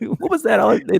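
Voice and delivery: high, nervous covering voice; meek henpecked husband. Retold or imitated. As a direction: play the whipped husband lying to his wife. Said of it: "stereotypical whipped husband".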